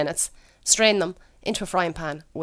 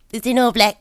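a light impression of the husband, a touch louder; imitating husband; 3 semitones higher, 5 dB louder than usual. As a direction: mimic him asking, mildly put out. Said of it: "This is what he does".

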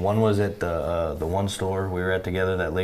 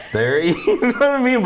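laughing; 8 dB louder than usual. Real, not acted. Laughs mid-sentence while telling story.

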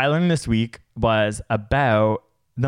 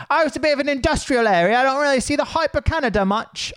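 much higher, fast put-on British accent; British tourist accent; 14 semitones higher, faster than usual. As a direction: play a British tourist admiring a corner. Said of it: "pretend I'm from Britain".

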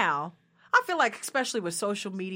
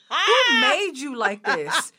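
loud, drawn-out comic disbelief; exasperated outrage; 4 semitones higher, 8 dB louder, much slower than usual. Acted. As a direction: demand it slowly, half laughing. Acted outrage at hair touchers.

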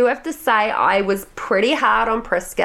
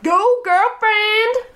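much higher, slow drawn-out cheer; 13 semitones higher, 3 dB louder, much slower than usual. Retold or imitated. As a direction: cheer her on from the couch. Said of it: "And I was like".

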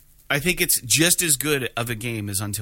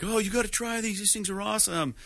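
higher-voiced eager jock impression; jock pitching snacks; 8 semitones higher than usual. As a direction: act out a guy talking up snacks, pitched up. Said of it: "he's trying to tell, like, the hot girl he's after".

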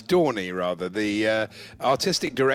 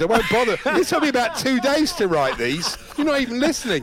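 mock indignant; 9 semitones higher, 5 dB louder than usual. Real, not acted. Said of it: "I do apologise".

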